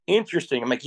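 normal talking voice

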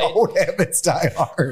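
slow, laughing the words out. Real, not acted. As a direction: chuckle through the line. Co-host laughing at the joke.

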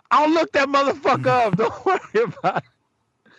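loud, high-pitched mock threat; hammed-up bluster, voice pushed way up; talking trash. Dad insists he will find the kid anyway, loud blustering trash talk. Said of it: "talking my shit".

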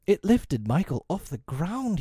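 high, slow and eager; a sudden hopeful idea; cried out. Acted. Peter crying out to Wendy about the kite, eager. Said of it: "he cried".

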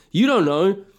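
usual speaking voice

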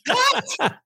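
high-pitched, hammed-up disbelief; incredulous. Batter reacting to his home run called out, incredulous. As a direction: yelp it as a mock protest. Batter disputes second out call.

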